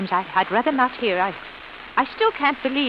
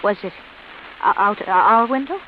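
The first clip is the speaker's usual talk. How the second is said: frightened stammer; 4 dB louder than usual. Acted. Frightened woman hears of suicide.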